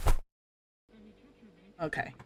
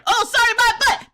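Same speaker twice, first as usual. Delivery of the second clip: much higher and louder, scoffing at "sorry"; sarcastic retort; 13 semitones higher, 5 dB louder than usual. Real, not acted. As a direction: throw "sorry" back with disbelief. Mocking the chat's apology.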